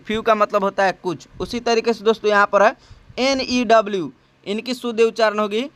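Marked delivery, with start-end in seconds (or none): none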